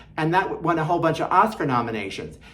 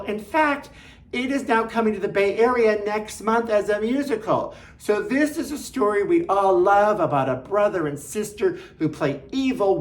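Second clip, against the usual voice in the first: ordinary delivery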